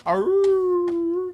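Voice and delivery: high, loud yelping arr; mock dog bark. Shown as a demonstration. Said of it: "it's not really a".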